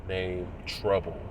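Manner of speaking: ordinary talk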